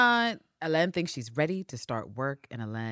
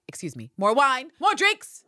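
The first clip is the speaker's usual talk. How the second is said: high, loud call for more drinks; calling for wine; 8 semitones higher, 5 dB louder than usual. Retold or imitated. Eve summoning the waiter, hammed-up loud call. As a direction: flag down the waiter, dramatically. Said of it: "And Eve is like".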